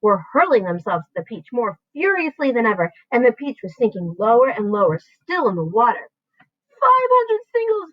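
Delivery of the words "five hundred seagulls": high, loud, slow; shouting. A narrator's reading. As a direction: shout the tally. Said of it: "James shouted".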